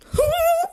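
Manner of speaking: high sudden gasp of shock; sharp shocked gasp. Retold or imitated. Showing how shocked she felt hearing God, sharp gasp. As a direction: gasp as if stunned. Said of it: "like that".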